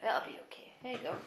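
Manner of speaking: high, soft reassurance. Real, not acted. Mother soothing her toddler, high and soft.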